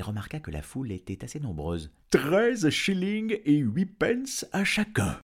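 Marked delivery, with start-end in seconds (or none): 2.1-5.2 high, slow, loud dragon voice; bargaining dragon. Acted. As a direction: draw out the offer on a raised pitch. Dragon makes his first offer. (dragon marchandeur, voix haute et traînante)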